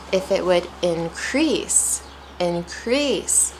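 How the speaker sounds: increase said in-CREASE, CREASE drawn out; stress on second syllable. Shown as a demonstration. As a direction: stretch CREASE each time in increase. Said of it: "it's a stylistic approach".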